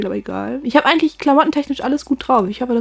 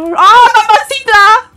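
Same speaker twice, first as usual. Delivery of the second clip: long, high, loud stretched cry; loud exclamation; 11 semitones higher, 8 dB louder, slower than usual. Real, not acted. Racing gamer yelling at danger, high, loud and slow. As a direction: belt out the word slowly in alarm. Horse race panic.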